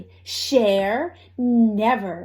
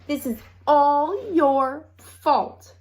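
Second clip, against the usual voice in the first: louder, higher, slow accusation; accusing; 5 semitones higher, 4 dB louder, slower than usual. A narrator's reading. Hen blaming the other hen, accusing. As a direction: point the blame hard. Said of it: "each hen accused the other".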